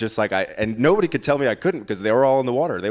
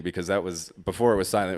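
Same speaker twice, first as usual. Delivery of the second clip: ordinary delivery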